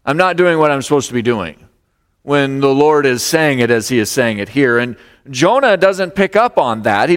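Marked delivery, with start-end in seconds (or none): none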